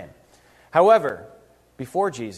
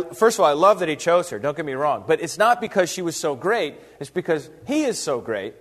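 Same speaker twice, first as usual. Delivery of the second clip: usual speaking voice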